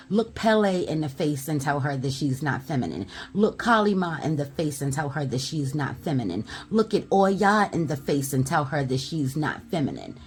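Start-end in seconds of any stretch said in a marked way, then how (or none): none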